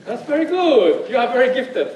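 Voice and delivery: loud, high praise to the whole room. Real, not acted. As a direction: boom it out over the audience. Joking response to loud-voiced audience.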